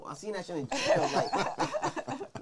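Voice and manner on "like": laughing. Real, not acted. Laughing while retelling jail fight.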